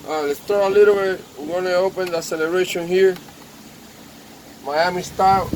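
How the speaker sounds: ordinary talk, nothing marked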